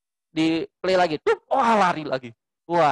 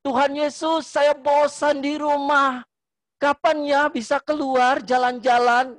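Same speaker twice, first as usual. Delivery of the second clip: higher, slow, calling out to Jesus; slow, raised-pitch prayer meant to be overheard; loud prayer; 8 semitones higher than usual. Shown as a demonstration. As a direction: pray out loud so the parents hear. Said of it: "Yang banter ngomongnya".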